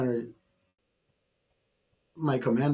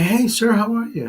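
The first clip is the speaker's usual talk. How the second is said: high, lilting acted hello; upbeat greeting; 8 semitones higher than usual. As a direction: greet the driver in a raised, chirpy voice. Acted cheery greeting to driver.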